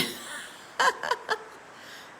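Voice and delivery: long, high, breaking laugh; overwhelmed laugh. Real, not acted. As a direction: dissolve into high laughter at the problem. Laughs at the booth shortfall.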